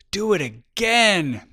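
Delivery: high, loud, drawn-out call from below; excited child demanding an encore; delighted little girl. A narrator's reading. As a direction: call up eagerly, stretching the words out. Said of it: "a little girl beaming with delight".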